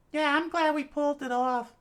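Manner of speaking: high loud voice, teasing then thankful; grateful girl. Acted. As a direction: thank Chikara warmly. Character high.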